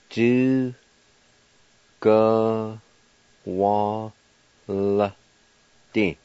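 Du, ga, wa, L, D spoken one at a time; syllable by syllable. Shown as a demonstration. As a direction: spell out the sounds slowly. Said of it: "Pronounced like this".

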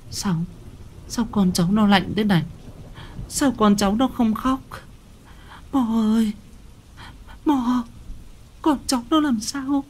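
put-on high, thin, pleading old-woman voice; frightened mother. Weak mother pleading with the shaman about her cold babies. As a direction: beg the shaman in rising panic.

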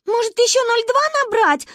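put-on high, loud excited child; frantic. A narrator's reading. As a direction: blurt it out. Manya grabs phone. (взволнованно)

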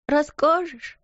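put-on high, sniffly childish question; sobbing child. A narrator's reading. Little girl asking her friend through sobs. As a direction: ask through a sob. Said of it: "всхлипнула Лотти".

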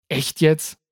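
gereizt und laut hingeworfen; wütend angefahren. A narrator's reading. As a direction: die Reporterin wütend anfahren. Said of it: "fuhr Jessica die andere wütend an". (Jessica fährt die Reporterin wütend an)

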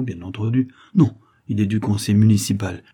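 plain reading voice

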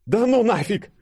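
very high, loud squawked exclamation; crying out. A narrator's reading. Denis bailing out as the monster charges, high panicked cry. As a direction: yell it in panic, high-pitched. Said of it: "выкрикнул Денис".

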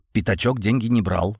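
ordinary delivery

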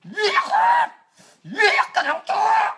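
very high, drawn-out angry screech; high-pitched furious squeal; shrieked curse. Real, not acted. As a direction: scream it out high and slow. Furious curse at end of rant.

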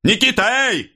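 very high summons with a falling «эй»; loud call. A narrator's reading. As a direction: call out for someone in the crowd. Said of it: "громко позвал Становой, оглядываясь". (громко, высоко подзывая)

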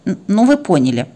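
ordinary delivery, nothing marked

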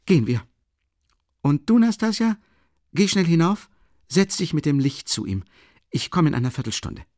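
lightly voiced excited young friend; Rasumichin, eager. A narrator's reading. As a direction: take charge eagerly. Rasumichin giving orders.

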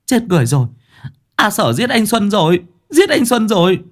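high panicked scream. A narrator's reading. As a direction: scream for help in terror. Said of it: "kêu lên".

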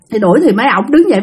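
ordinary delivery

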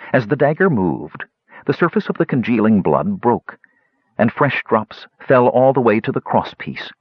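plain reading voice

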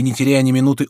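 plain reading voice

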